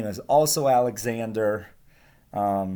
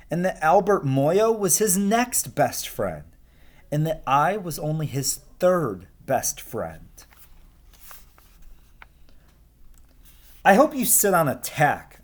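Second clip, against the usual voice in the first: lively, higher storybook voice for the class; reading to children; 4 semitones higher than usual. Acted. Teacher reading Alexander to her class, lively storytelling voice. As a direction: read it like a picture book to kids. Teacher reads picture book aloud.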